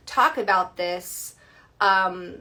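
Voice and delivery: ordinary delivery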